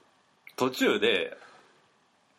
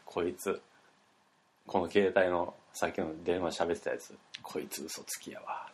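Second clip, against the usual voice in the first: dropping to a secretive whisper about the caller; hushed gossip; 6 semitones lower, 7 dB quieter than usual. Retold or imitated. Old man whispering his complaint about the caller to the visitor.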